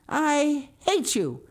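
high, loud, drawn-out outburst of hatred; choked, hateful cry. A narrator's reading. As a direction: cry it out slowly and passionately. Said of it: "she cried in a choked voice".

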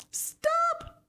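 very high, loud command; tiny husband calling to the ducks; called out. Acted. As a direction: call down sharply. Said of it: "called the tiny Mister Gregg".

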